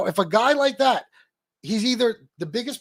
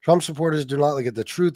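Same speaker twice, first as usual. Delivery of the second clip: normal talking voice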